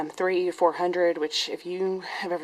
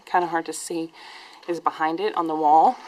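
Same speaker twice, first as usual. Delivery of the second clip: normal talking voice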